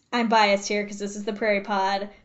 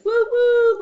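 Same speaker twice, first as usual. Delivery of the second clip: train whistle; 12 semitones higher, 7 dB louder than usual. Pretend. Mimics train whistle, learning train.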